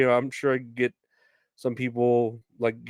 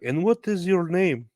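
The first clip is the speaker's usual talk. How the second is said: higher, pointed question from the caller; customer on the phone; 7 semitones higher than usual. Retold or imitated. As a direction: ask it as a prim, higher-pitched caller. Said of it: "she's like".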